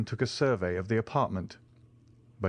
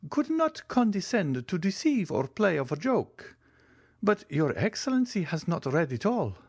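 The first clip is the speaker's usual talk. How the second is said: the Italian major; 10 semitones higher than usual. A narrator's reading. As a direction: flatter the count eagerly.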